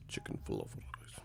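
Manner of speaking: breathy whispered chant of the food names. Pretend. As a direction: whisper the made-up song. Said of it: "a Middle Eastern song next".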